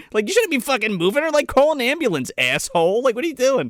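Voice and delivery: high, exasperated mock rebuke; indignant scolding. Viewer berating the careless lawyer on screen, high indignant scolding. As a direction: tell him off in high-pitched disbelief. Scolding the film's character, incredulous.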